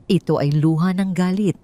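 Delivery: ordinary delivery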